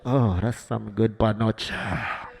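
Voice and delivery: low, slow mock seductive purr. Comic showing the crowd how to talk sexy in bed, slow mock seductive. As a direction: drawl it like a hammed-up lover. Pretend lover speaking Spanish.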